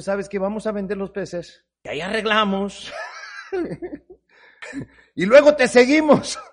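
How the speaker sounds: playful with a laugh; joking scheme. Acted. Preacher joking how we'd sell the fish first. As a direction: tease like a clever dealer.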